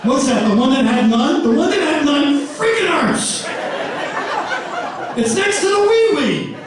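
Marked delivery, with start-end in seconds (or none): none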